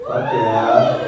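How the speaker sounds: high, squealing fuck yeah; stoner cheer. Real, not acted. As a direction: whoop it up like a happy stoner. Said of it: "love to smoke marijuana".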